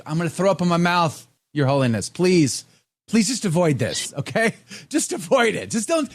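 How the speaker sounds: high, pleading, exasperated; exasperated plea. Retold or imitated. Host pleads with the Pope, high exasperated mock plea. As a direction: beg the Pope to just stop, laughing. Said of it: "It was like".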